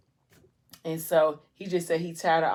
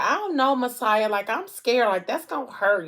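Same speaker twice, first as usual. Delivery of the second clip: high, louder worried protest, acted out; reluctant and scared; 6 semitones higher, 4 dB louder than usual. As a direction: imitate a friend balking at pain. Friend scared of getting a tattoo.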